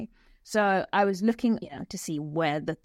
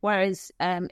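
usual speaking voice

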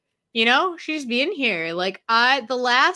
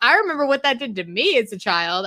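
ordinary delivery

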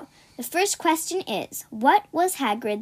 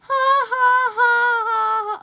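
mock laugh; 10 semitones higher, 5 dB louder, much slower than usual. Fake laugh after joke.

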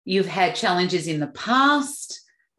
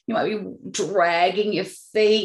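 ordinary talk

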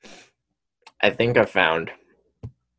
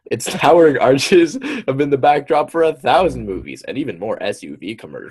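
lively, higher-pitched article narration; article read-aloud; 5 semitones higher, 5 dB louder than usual. Acted. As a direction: read it out like a dramatic feature story.

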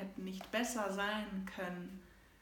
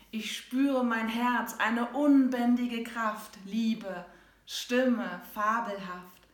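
loud, triumphant climax; 2 semitones higher, 9 dB louder, slower than usual. Acted. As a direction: build to full power. Poem climax, empowered ending.

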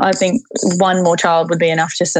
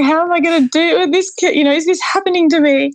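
retold crying; 10 semitones higher than usual. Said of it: "I was crying the whole way to the car".

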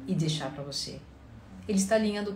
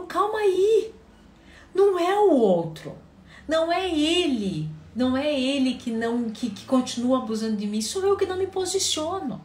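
sudden realization; 7 semitones higher, 4 dB louder than usual. Retold or imitated. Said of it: "E daí ela lembrou".

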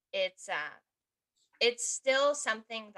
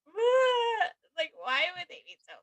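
hurt crying protest; 8 semitones higher than usual. Retold or imitated. Her teen self cries over others being mean, high tearful outcry. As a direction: wail it as the wounded teen.

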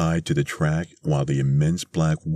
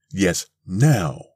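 mildly raised, insistent retort; insistent cry; 3 semitones higher, 4 dB louder than usual. A narrator's reading. Missus Bellows presses Mister Thurkell to act, insistent. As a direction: press the demand with a modest cry. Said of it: "cried Missus Bellows".

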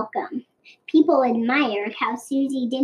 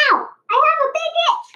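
much higher, squeaky cry of itchy discomfort; much faster than usual. A narrator's reading. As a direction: yelp like a snake that itches all over. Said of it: "Susie cried".

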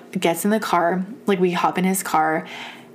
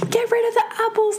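much higher, frantic plea; panicked high cry; faster than usual. Retold or imitated. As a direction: beg in panic to ditch the apples. Panicking about the wasp.

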